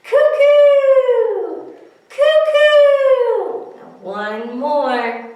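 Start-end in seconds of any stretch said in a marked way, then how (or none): 0.0-3.8 very high, loud cuckoo call falling away. Acted. Teacher playing the cuckoo bird for the kids, high and loud. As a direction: pop out like the clock bird. Cuckoo clock striking in song.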